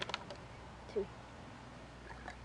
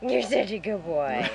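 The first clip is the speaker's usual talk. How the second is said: put-on talking to a baby; 6 semitones lower, 6 dB quieter, slower than usual. Cooing to drink like pet.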